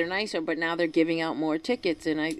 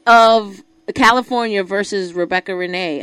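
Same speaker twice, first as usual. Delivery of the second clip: loud proclamation; 10 dB louder than usual. Real, not acted. Announcing her own court case.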